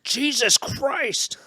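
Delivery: high, long-drawn mock yell; imitated yell. Man stuck in his cinema seat, high drawn-out mock yell. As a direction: stretch it out as a mock exasperated cry. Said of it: "And he yells".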